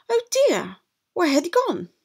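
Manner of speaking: high, fretful gasp of worry; alarmed mother. Acted. Posh mother frets her son has vanished, high and alarmed. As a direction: panic rising over the lost son.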